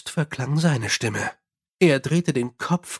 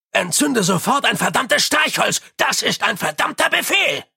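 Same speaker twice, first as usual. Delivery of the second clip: much higher, urgent yelled command; shouted order; 8 semitones higher than usual. A narrator's reading. Mumm at Nobby in the dark, a shouted order. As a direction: order him at the top of your voice. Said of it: "schrie Mumm".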